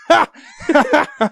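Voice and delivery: loud high jeering ha ha; mocking laugh. Real, not acted. Jeering at the stuck group through the window, loud laughing. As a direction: laugh right at them, jeering.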